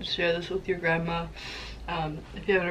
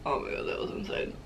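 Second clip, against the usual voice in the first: whispered breathless; 5 dB quieter than usual. Real, not acted. Breathless after spicy food.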